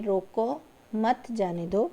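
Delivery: short break after "روکو", then "مت جانے دو" as one group; pause after روکو. Shown as a demonstration. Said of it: "روکو کے بعد علامت لگائی گئی ہے".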